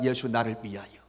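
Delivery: ordinary talk, nothing marked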